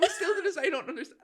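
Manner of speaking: very high, cracking with laughter; laughing disbelief. Retold or imitated. Comedian recalling the naked man, laughing disbelief. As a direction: squeak it out while laughing. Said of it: "I was like".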